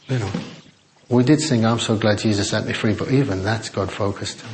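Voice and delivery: usual speaking voice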